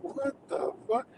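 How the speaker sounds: high, hushed mock disbelief; stunned whisper. Streamer reacting to alarmist online comments, stunned whisper. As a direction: whisper it, as if dumbfounded.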